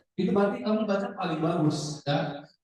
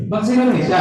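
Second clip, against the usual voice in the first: loud "Bangsa Indonesia" as a question; question intonation; 3 semitones higher, 9 dB louder, much faster than usual. Shown as a demonstration. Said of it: "belakangnya tanda tanya, coba nadanya".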